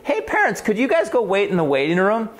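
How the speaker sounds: a sudden high call to the parents, then settling; high call to the parents to step out. Retold or imitated. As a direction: pitch jumps way up on "Hey, parents". Calling parents out of room.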